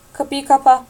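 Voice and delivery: loud barked command; furious yell to shut the door; yelled order. A narrator's reading. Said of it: "diye bağırdı Darby".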